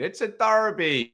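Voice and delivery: a half-hearted Irish Roy Keane impression; Roy Keane Irish accent. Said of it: "in the words of Roy Keane".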